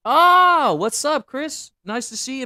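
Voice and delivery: high, loud "Oh" of sudden delight; light up as a friend shows up; bright surprised hello to a viewer; surprised greeting. Real, not acted. Greeting a new viewer Chris.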